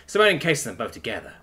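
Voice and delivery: plain reading voice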